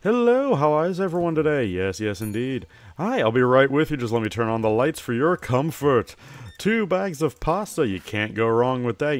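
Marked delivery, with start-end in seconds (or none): 0.0-1.6 high bright sing-song shop greeting, louder; cheery shopkeeper. Acted. Shopkeeper hailing a customer entering the store, cheery and loud. 3.0-9.2 high bright sing-song shop greeting, louder; cheery shopkeeper. Acted. Shopkeeper hailing a customer entering the store, cheery and loud.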